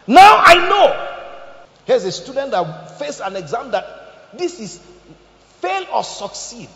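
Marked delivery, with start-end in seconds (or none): 0.0-1.1 slow, shouted, much louder; God shouting in triumph; booming proclamation. Acted. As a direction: thunder it out slowly. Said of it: "He said to Abraham".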